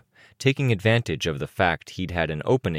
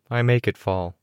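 usual speaking voice

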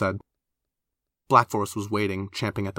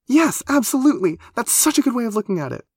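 high, loud, gushing agreement; excitable high-pitched voice; huge relief; 5 dB louder than usual. A narrator's reading. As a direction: agree eagerly, flooded with relief. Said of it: "it was a lot of relief".

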